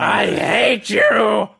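slow drawn-out mock shout; mock Anakin wail. Friend quoting Anakin, slow mock wail. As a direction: wail it slowly.

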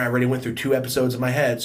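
normal talking voice

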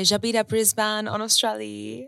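French phrase. Retold or imitated. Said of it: "I used to say".

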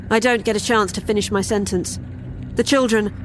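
plain reading voice

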